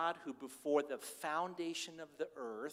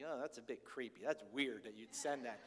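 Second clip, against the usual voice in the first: lower, soft mock-disapproving daughter; a hint of a put-off daughter; skeptical daughter; 6 semitones lower, 5 dB quieter than usual. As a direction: daughter finding it creepy, lightly voiced. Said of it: "she's like".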